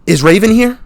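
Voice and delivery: put-on high small girl's voice; little girl. Neighbor girl at the door asking, high child voice.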